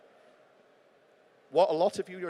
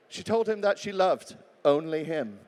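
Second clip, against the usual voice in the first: "only him" held back and set apart at the end; stress on ONLY HIM; 5 dB louder than usual. Shown as a demonstration. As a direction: land deliberately on "only him". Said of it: "Put the word only in between".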